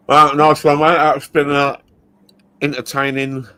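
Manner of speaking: talking with mouth full of food. Real, not acted. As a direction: speak through a mouthful. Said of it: "you're speaking with your mouth full again".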